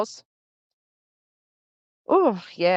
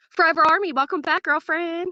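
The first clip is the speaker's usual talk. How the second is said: excited greeting; 8 semitones higher than usual. Real, not acted. Greeting a returning chatter.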